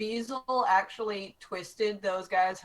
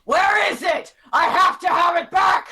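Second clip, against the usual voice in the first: higher and much louder roar; the angry king demanding his stolen item; booming voice; 10 semitones higher, 12 dB louder than usual. Acted. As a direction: bellow it across the courtyard. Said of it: "a booming voice".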